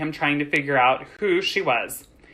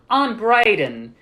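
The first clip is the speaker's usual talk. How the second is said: slightly higher, slower introduction; lightly voiced boy; 6 semitones higher, 4 dB louder, slower than usual. A narrator's reading. Young master introduces himself to servant girl, lightly voiced boy. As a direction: introduce yourself in a light boy's voice.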